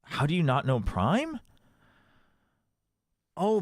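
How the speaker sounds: ordinary talk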